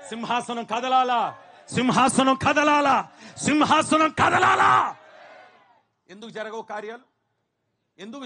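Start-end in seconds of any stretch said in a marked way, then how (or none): 0.1-5.0 building preaching shout. Acted. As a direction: drive each repeat harder until it peaks. Repeated call rising to shout. (నెమ్మదిగా పెరుగుతూ అరుపుగా మారే ప్రసంగం)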